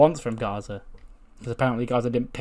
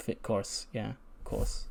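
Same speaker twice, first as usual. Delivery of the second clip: very soft, let-down quote; quiet resignation; 11 dB quieter, slower than usual. Retold or imitated. Partner refused an answer by his teammate, quietly resigned. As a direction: act out muted disappointment.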